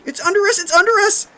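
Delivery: repeated high shrieks of panic; screaming. A narrator's reading. Kathy warning friends over and over, screaming. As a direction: keep shrieking it, frantic. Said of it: "Kathy continued".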